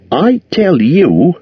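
high, slow hobbit voice; a small hero keeping his end up; Bilbo, polite and nervous. A narrator's reading. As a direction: hobbit speaking up bravely. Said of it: "in an effort to remain loyal".

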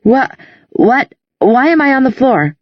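dazed, waking up confused; groggy confusion. A narrator's reading. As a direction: come to, bewildered. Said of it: "had already begun to stir".

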